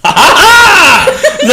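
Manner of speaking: slow, loud high ha ha ha; hammed-up high cackle; mock evil laugh. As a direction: belt out a hammy villain laugh. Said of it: "villain laugh read aloud".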